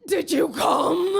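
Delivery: drawn-out yelled question; slow shout. Acted. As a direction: bellow it slowly for the punchline. Loud punchline of sex story.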